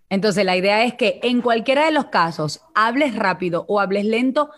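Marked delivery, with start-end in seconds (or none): none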